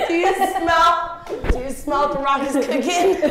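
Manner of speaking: loud, drawn-out catchphrase voice, pitched high with laughter; The Rock. Acted. Joker quoting a wrestling meme to a friend, loud laughing impression. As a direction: stretch it like a wrestler's catchphrase, cracking up. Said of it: "like, the meme with The Rock".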